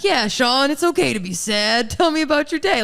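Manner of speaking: sweetly coaxing him to open up; mock comforting girlfriend. Girlfriend playfully comforting her sad man, high, loud and hammed-up soothing. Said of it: "she's like".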